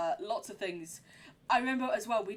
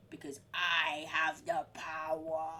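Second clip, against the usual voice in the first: mock villain; 4 semitones lower, slower than usual. Villain boast at game.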